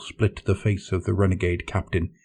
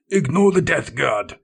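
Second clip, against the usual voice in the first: shouted; 8 semitones higher than usual. Acted. Captain commanding his fleet over the alarms, shouting. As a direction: bark it across the bridge. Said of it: "he shouted".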